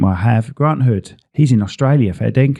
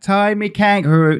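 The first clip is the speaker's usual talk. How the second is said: teasing nickname; 9 semitones higher than usual. Acted.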